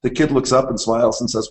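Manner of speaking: ordinary talk, nothing marked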